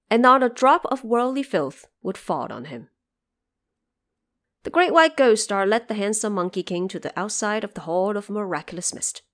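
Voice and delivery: ordinary talk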